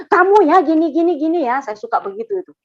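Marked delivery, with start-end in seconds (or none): 0.1-1.6 raised, louder mock scolding; meniru omelan sendiri. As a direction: act out how you used to point at people, high and loud. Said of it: "Dulu kalau saya ngomong". (menirukan omelan lama dengan nada tinggi)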